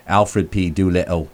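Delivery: Alfred P. Doolittle in a light Cockney accent. Shown as a demonstration. To the host, demoing his character's Cockney accent. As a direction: say the name like a Cockney. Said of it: "would be like".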